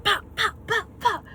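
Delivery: high excited pops, each punched; excited pop sounds. Real, not acted. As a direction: rattle them off with glee. Miming the head smashes.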